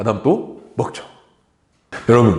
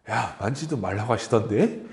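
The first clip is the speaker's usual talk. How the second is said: softer, mildly sulky grumble; grumbling complaint; 3 semitones lower, 6 dB quieter than usual. Retold or imitated. Eve griping to the serpent, a touch sulky and softer. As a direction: mutter it with a hint of complaint. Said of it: "불만을 표하는 거예요".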